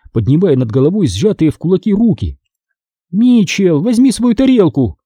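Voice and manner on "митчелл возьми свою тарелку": high-pitched exasperated order; commanding. A narrator's reading. Mom sends son from the table, commanding. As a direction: order him off, moderately forceful. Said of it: "приказала мама".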